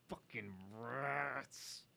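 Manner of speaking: slow, drawn-out growl of exasperation; mock anger. Reading about rats eating birds' eggs, mock anger. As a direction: drag out the disgust, then burst into a loud groan. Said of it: "it makes me so angry".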